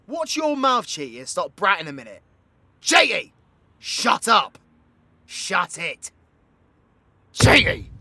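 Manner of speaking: loud, high-pitched scolding shout; exasperated shouting. Acted. Telling Cheaty to shut up and calm down, exasperated shout. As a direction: shout them down, urgent and exasperated.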